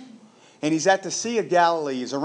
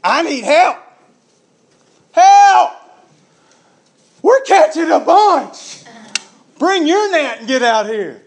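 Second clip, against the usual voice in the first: loud shouts across the water, much higher; calling out for help; 11 semitones higher, 12 dB louder, slower than usual. Retold or imitated. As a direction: yell to the shore for more hands, loud. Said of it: "and he calls out to the other guys".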